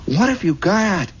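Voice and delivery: high, slow cooing question; put-on high lady voice; neighbor lady. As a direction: ask like a fussing lady next door. Neighbor asks to see ring.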